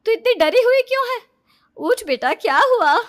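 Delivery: loud, urgent motherly calling; alarmed mother. Acted. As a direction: rouse a sleeping daughter in worry. Mother waking daughter from nightmare.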